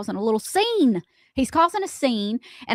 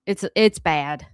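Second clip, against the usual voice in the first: usual speaking voice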